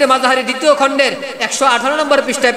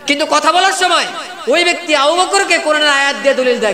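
usual speaking voice

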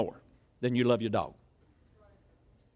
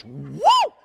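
cat peeling out; 4 semitones lower, 5 dB louder than usual. Retold or imitated. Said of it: "peeled out all fours like this".

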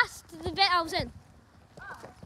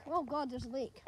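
normal talking voice

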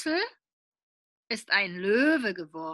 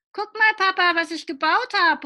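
put-on higher, louder child's call; Willi calling out; 8 semitones higher, 6 dB louder than usual. Child calls to his father, higher and louder. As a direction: call out proudly like a small boy. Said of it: "ruft Willi".

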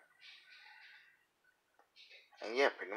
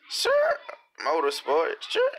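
squeaky high voice; 6 semitones higher, 4 dB louder, slower than usual. Pretend. Squeaky joke voice.

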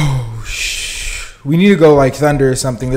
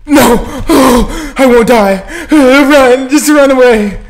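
panicked yelling; 11 semitones higher, 11 dB louder, slower than usual. Real, not acted. Fleeing enemies in game.

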